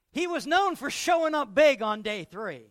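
loud, high preacher's punch line; big booming proclamation; preaching shout. Acted. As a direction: belt it out to the congregation.

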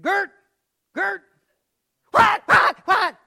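high, loud wake-up shout; excited shout. Retold or imitated. Father waking mother in the night, shouting. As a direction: shake her awake and shout it.

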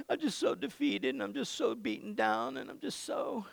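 lightly voiced whimper; mock whining. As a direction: mimic a self-pitying complainer. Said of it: "Instead of going".

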